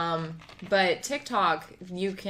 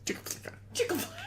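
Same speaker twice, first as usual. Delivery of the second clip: whispered, strangled "Chick-a-Flay"; like a held-in sneeze; 7 dB quieter, slower than usual. Retold or imitated. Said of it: "sounded like you, like, had to sneeze".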